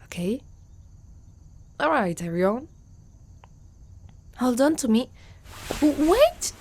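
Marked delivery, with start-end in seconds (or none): 5.8-6.5 high, loud flustered cry; startled yelp. Acted. Centaur startled as the rider grabs her, loud flustered yelp. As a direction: yelp it, caught off guard.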